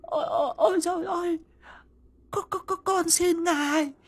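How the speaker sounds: high, wailing plea; begging in terror. A narrator's reading. Mrs Dậu begging the ghost for mercy, high terrified wailing. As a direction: grovel and cry for mercy. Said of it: "méo mó van xin".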